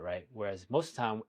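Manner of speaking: ordinary talk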